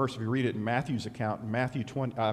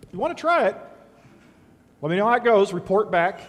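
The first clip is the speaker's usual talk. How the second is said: high, louder teasing challenge; mock dare; 5 semitones higher, 7 dB louder, faster than usual. Real, not acted. Preacher daring husbands to call their wives Satan, teasing and louder. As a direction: dare them, grinning. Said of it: "teasing dare to the congregation".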